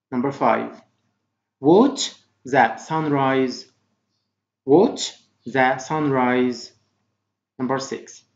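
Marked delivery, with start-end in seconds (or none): 1.6-6.7 first word lifted, then a slow drop; slow model reading. Shown as a demonstration. Teacher reading vocabulary for pupils to repeat, slowly. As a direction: model it slowly in separated chunks. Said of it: "Try to repeat after me".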